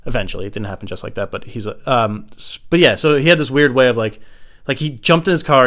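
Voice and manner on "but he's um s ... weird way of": ordinary talk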